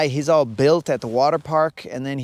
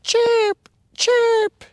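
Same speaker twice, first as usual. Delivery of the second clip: very high, piercing little bird chirp; wussy eagle chirp; 6 dB louder than usual. Retold or imitated. Majestic bald eagle opens his mouth, wussy high chirp. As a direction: squeak loudly like a wimpy bald eagle. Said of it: "when he opens his mouth, he's all like".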